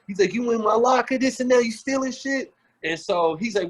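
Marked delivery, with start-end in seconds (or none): 0.3-2.5 higher and louder, a retold veteran chewing out a rookie; imitating an angry accusation. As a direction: play the furious veteran barking at the rookie, higher pitched.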